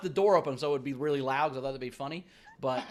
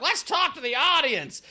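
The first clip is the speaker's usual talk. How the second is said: drunk imitation; 8 semitones higher, 5 dB louder, slower than usual. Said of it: "you're like".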